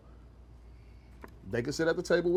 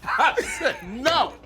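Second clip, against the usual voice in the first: laughing; 8 semitones higher, 4 dB louder, much slower than usual. Real, not acted. Echoing while laughing.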